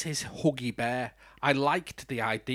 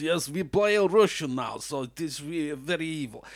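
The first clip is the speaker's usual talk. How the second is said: lightly put-on Russian accent; a bad Brit doing Russian villain; hint of a cheesy Russian accent; mock Russian accent. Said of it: "us Brits and Russians".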